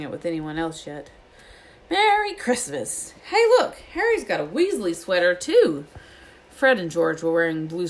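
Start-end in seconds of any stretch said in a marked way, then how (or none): 1.9-5.9 loud, high boisterous greeting; exuberant shout. A narrator's reading. Fred and George bound into the dormitory, shouting excitedly. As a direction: burst in crowing with excitement. Twins burst in on Christmas morning.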